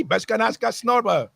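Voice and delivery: ordinary delivery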